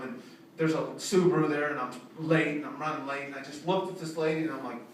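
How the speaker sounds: ordinary talk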